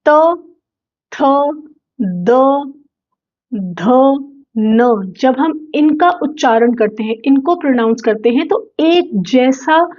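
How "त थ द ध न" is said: त थ द ध न, tongue behind the teeth; dental row drill. Shown as a demonstration. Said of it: "अगर आपने नोटिस किया हो". (त वर्ग का उच्चारण)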